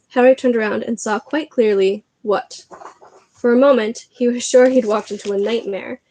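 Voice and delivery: plain reading voice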